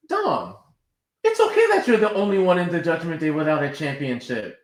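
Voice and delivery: high, fast patronizing reassurance; condescending. Retold or imitated. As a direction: talk down sweetly to him.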